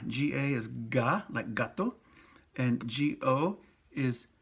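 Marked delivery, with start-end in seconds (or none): 0.1-1.2 ga said slowly with a hard g, open a; soft ga. Shown as a demonstration. Teacher to Spanish learners, slow clear ga. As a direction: spell it out, then a clean hard ga. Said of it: "So, ga".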